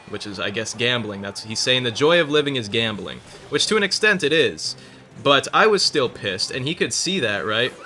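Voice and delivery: usual speaking voice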